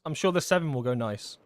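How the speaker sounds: ordinary talk, nothing marked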